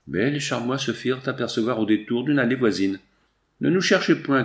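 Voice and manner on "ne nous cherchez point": mild, higher-pitched call; lightly acted narrator's cry. A narrator's reading. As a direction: call out as if to people searching, without a full shout. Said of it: "criait-il".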